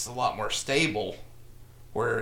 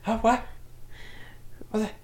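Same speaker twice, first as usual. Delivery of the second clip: much higher, baffled tough guy; 10 semitones higher than usual. Acted.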